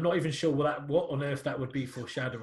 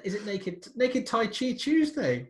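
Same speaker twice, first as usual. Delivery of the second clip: higher, louder, slow incredulous question; mock disbelief; 7 semitones higher, 5 dB louder, slower than usual. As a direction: stretch it out as if it can't be true. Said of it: "each time I'm like".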